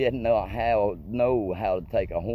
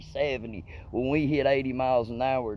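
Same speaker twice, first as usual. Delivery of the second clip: usual speaking voice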